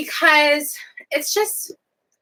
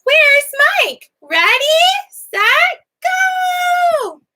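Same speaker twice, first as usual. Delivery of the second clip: squeaky high shouted puppet voice; 5 dB louder, much slower than usual. Pretend. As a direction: call it out like an excited puppet. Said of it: "I use Mike and Meg when it's like".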